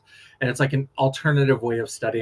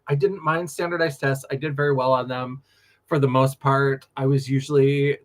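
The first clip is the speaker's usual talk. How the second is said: ordinary delivery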